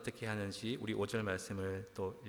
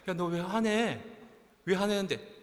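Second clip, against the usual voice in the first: raised, higher-pitched confrontation; exasperated questioning; 8 semitones higher, 7 dB louder than usual. Acted. God asking Jonah why he is angry, raised exasperated questioning. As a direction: call after someone storming off, demanding an answer.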